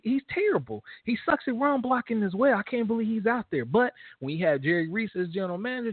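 ordinary talk, nothing marked

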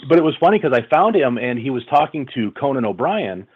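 ordinary talk, nothing marked

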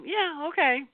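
high, sweet yeah, okay; smiling fake yes. Venus smiling while refusing, sweet high agreement. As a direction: sound agreeable, mean no. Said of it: "she's just smiling at you".

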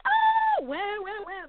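overwhelmed at the house pictures; amazed gasp. Retold or imitated. Retelling seeing her friend's new house, a squealing wow. I'm crying 'cause I saw pictures.

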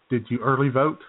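usual speaking voice

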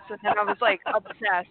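ordinary talk, nothing marked

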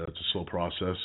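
usual speaking voice

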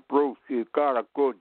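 imitating a Chicago tough guy's Dems and Does accent; Chicago tough-guy talk. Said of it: "That's the way we used to talk in Chicago".

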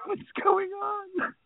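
high, slow worried mother; high mom voice. Retold or imitated.

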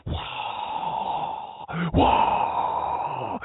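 long, breathed fake crowd cheer; imitated crowd roar. Host faking a live audience's applause, as a hushed crowd roar. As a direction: exhale a drawn-out roar like a distant crowd. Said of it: "You hear the crowd?".

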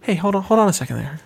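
laid-back crooner stopping someone mid-scream; lightly voiced unbothered crooner. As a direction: ease them down like a mellow singer. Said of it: "That's how he talks".

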